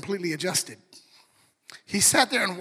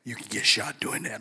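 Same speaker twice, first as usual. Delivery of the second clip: deep dad voice; 11 semitones lower, 5 dB quieter than usual. Retold or imitated. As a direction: drop the voice way down like a big dad catching his son. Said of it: "To hear that voice go".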